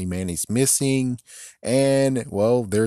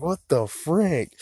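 drawn-out disbelief; 8 semitones higher, slower than usual. Retold or imitated. Stunned reaction to eviction.

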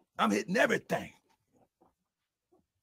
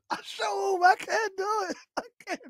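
high, squeaky laughing voice; laughing too hard to talk; helpless laughter; 8 dB louder than usual. Real, not acted. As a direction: barely get it out through the laughs. Cracking up over what was said.

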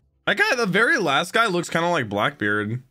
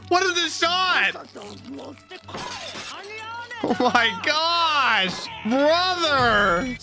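high-pitched, stretched-out disbelief; amazed outcry; 12 semitones higher, slower than usual. Real, not acted. Viewer amazed at a shot in the show, high drawn-out exclamations. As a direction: cry out high, dragging the words in amazement.

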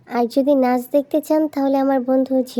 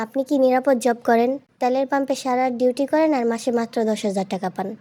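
usual speaking voice

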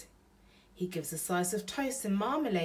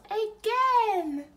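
put-on much higher, loud gleeful baby shout; baby's shout; 8 dB louder than usual. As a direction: squeal it like a delighted toddler. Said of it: "The baby laughs and shouts".

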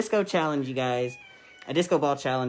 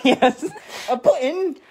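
high, loud mock-British introduction; hammed-up British accent, pitched up; attempted British accent; 11 semitones higher, 10 dB louder than usual. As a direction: announce the cat in over-the-top British. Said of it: "that accent you're doing".

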